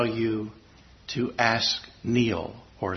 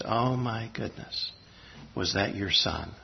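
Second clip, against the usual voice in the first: hushed gentle question; 3 semitones lower, 8 dB quieter than usual. Retold or imitated. Gently asking a grieving biker.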